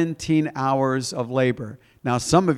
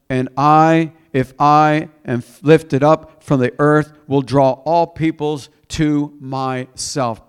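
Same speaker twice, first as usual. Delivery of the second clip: slow, loud proclamation of a verse; emphatic scripture reading; 5 dB louder, slower than usual. Acted. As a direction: proclaim it with drawn-out weight. Reads scripture aloud.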